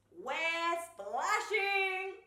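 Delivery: high, loud, drawn-out holler; big storybook yell; excited shout. A narrator's reading. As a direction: shout it out like a gleeful playmate. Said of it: "they shouted".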